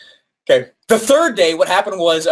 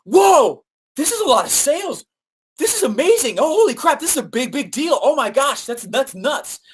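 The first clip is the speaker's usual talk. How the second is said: high-pitched, giddy rush of amazement; overwhelmed excitement; 9 semitones higher than usual. Retold or imitated. Client phoning about the flood of sales, high excited gush. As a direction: sound like the orders won't stop.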